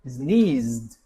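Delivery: "Sneezed" gliding from Z straight into D; z to d ending. Shown as a demonstration. Teacher slows down the zd ending. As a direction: slide from the Z into the D. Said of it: "zd, vai do Z pro D".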